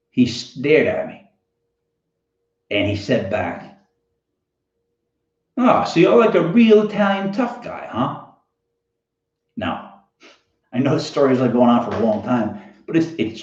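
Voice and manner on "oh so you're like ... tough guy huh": higher-pitched, needling challenge; mocking taunt. Kid taunting the comedian after being threatened, mocking.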